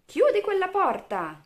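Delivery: high, loud storybook shout; shouted order. A narrator's reading. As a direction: yell it across the room. Character calls out an order.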